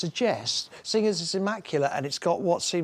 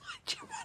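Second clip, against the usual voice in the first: sudden high squeaky laugh; burst of laughter; 16 dB quieter than usual. Real, not acted. Mocking his mate's telly find with a high burst of laughter.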